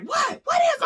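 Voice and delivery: high panicked shout to come downstairs; frantic yell. Retold or imitated. Calling husband down, high panicked yell. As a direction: yell as if your life depends on it. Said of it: "he's like".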